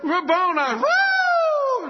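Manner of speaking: high loud wailing whoop; joyful wail. Retold or imitated. Mary Magdalene seeing Jesus risen, high joyful wail. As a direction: cry out to the risen teacher. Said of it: "she's probably wailing".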